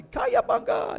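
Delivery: high, loud mock battle cry before jumping; hollered like a kid leaping into a pool; a playful splash-jump whoop; cannonball yell.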